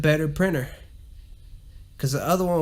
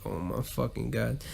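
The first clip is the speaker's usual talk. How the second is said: low, quieter, fed-up groan; exasperated groan; 4 semitones lower, 9 dB quieter than usual. Retold or imitated. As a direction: let it out like you're done. Exasperated retold reaction.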